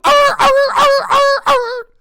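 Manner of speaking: high, loud siren-like wail; shrieking mock siren; siren imitation. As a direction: howl like the Ghostbusters car siren. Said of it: "driving around the motherfucking Ghostbusters car".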